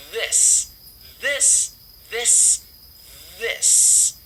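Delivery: THIS with a short, quick i and a hissed s; THIS, short i, tongue between the teeth; short vowel in THIS. Shown as a demonstration. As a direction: keep the vowel in THIS short and lax. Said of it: "é um som curtinho".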